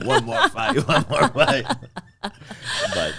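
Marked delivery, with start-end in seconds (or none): 0.0-1.6 laughing. Real, not acted. Mocking his old self, laughing.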